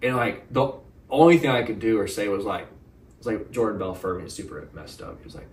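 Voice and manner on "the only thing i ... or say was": normal talking voice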